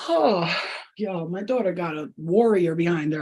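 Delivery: ordinary talk, nothing marked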